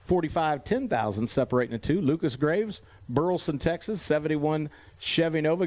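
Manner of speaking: ordinary delivery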